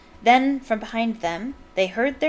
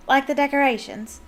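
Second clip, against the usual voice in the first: a hint of a bad Irish accent; lightly put-on Irish lilt, cheeky; amateur Irish accent for a proud fan; terrible Irish accent; 5 semitones higher than usual. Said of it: "my terrible Irish accent".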